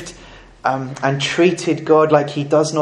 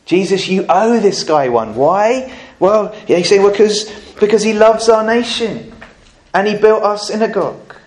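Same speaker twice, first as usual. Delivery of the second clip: mock pleading elders; 6 semitones higher, 4 dB louder than usual. Elders' argument acted out, raised.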